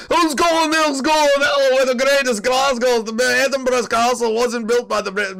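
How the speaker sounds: high-pitched, ranting Scottish caricature; mock Scottish babble. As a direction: babble like a wound-up Scot defending Scotland. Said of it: "babbling in a Scottish accent".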